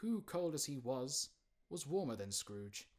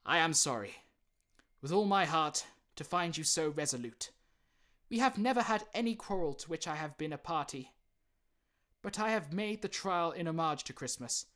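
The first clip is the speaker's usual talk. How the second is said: louder, sincere, cheerful farewell; kind nephew; 6 semitones higher, 10 dB louder than usual. Acted. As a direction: stay good-humoured to the end.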